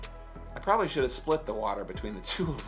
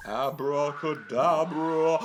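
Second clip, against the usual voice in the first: slow drawn-out magician incantation; magic spell; much slower than usual. Acted. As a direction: intone it like a stage magician. Magician spell over music.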